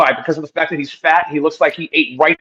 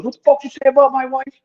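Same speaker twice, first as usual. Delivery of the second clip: mock an angry stranger squaring up; angry confrontation; 10 semitones higher than usual. Stranger running across the street, confronting him: high mock anger. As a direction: pitch jumps up high, imitating a man charging over angry. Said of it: "He goes".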